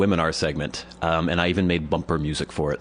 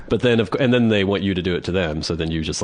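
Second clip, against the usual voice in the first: usual speaking voice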